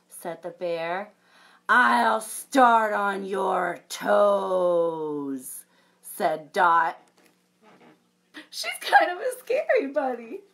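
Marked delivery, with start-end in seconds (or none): 1.7-5.6 very slow, loud threat; mock menace. Dot threatening the bear, very slow. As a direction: savour each word wickedly.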